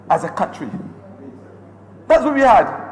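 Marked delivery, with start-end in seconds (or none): 2.1-2.9 higher and louder, hammering the point home; emphatic loud refrain. Real, not acted. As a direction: ring it out to the chamber like an accusation. Rising parliamentary attack, repeated refrain.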